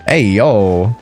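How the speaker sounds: normal talking voice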